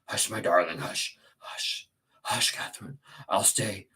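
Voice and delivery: calm her down gently; tender hushing. Acted. Heathcliff soothes frantic Catherine.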